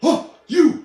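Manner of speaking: surprised shout. Real, not acted. Sudden exclaim.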